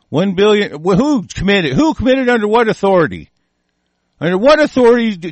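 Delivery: demanding answers, voice climbing; outraged questioning. Real, not acted.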